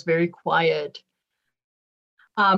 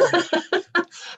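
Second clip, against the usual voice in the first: laughing; 11 semitones higher than usual. Real, not acted. Laughing about having no favorites.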